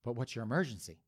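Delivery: calm dispatcher, hushed; soft low 911 operator. Retold or imitated. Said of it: "Nine one one operator's like".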